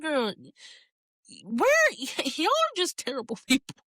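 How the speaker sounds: high, incredulous, laughing through it; can't believe what she's hearing; appalled giggle at terrible people; laughing disbelief. Retold or imitated. Outraged reaction to the lady.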